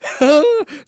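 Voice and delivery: high loud silly cackle; shrieking drunk laugh; drunken cackle. Retold or imitated. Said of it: "just going".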